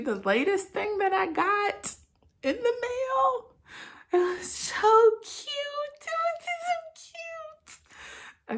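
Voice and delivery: high, slow, teary squeal over something cute; tearful squeal. Real, not acted. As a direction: melt over the tiny outfit, near tears. Squealing over a baby outfit.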